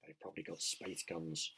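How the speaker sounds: police chief muttering darkly; muttered. Acted. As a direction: grumble it under your breath. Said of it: "muttered the chief police".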